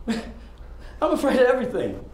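bitter laugh. Acted. Monologue, laughing through it.

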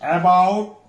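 about with the second syllable pushed and rising; teacher's demo of about, weight on BOUT; stress on second syllable. Shown as a demonstration. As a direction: lean into the end of about. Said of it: "Stress on second syllable".

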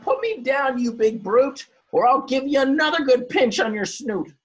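high, loud threatening crab voice; angry crab cry. A narrator's reading. Crab in the dog's jaws threatening the big dog. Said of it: "cried the crab".

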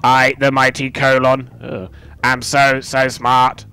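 stiff mechanical robot voice. Acted. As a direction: speak like a machine. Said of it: "like, a robotic kind of voice".

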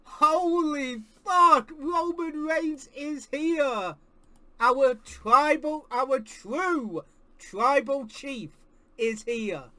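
slow, drawn-out awe; awed slow. Real, not acted. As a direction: savour every word in reverence.

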